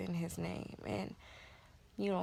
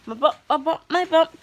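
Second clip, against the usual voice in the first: much higher and louder, chanting gleefully; excited sing-song; 9 semitones higher, 16 dB louder than usual. Real, not acted. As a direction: sing it out like a proud mom. Excitedly showing her bump.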